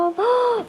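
sharp high gasp of amazement; impressed gasp. Acted. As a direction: gasp as if wowed by the room. Gasps at pantry reveal.

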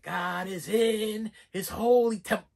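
a touch of the spooky preacher; hint of creepy old man. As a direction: murmur it like a half-heard hymn. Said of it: "old man".

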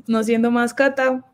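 ordinary talk

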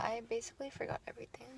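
fast hushed whisper. Real, not acted. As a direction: rush it out in a whisper. Aside confession.

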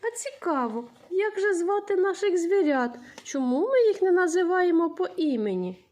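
teacher wondering aloud to keep pupils interested; playful wonder. Acted. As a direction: make the question sound exciting for kids. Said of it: "teacher engaging children playfully".

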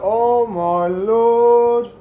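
very slow drawn-out oh my lord; amazed. Real, not acted. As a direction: stretch it out in awe. Seeing the egg explosion.

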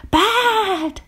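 very high little squeaky voice; squeak. A narrator's reading. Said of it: "little Doom squeaked".

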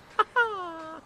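high, drawn-out haughty laugh; high theatrical laugh. Acted. Lord joking at the guards' expense, haughty laugh. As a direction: laugh high at your own joke.

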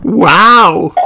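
high, loud wonder; Peppa's amazed cry. A narrator's reading. Peppa sees the Grand Canyon, crying out amazed. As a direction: cry out in awe. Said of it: "cried Peppa".

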